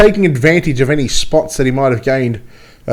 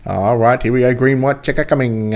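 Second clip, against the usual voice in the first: ordinary talk, nothing marked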